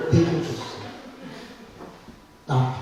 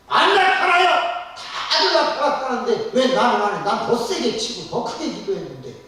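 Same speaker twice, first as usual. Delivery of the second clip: high and loud, laughing through the protest; indignant protest; 10 semitones higher, 5 dB louder than usual. Retold or imitated. Congregant protests to pastor that nothing appeared, high indignant cry. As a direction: insist nothing happened, pitched up and loud.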